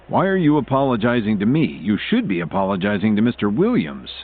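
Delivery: usual speaking voice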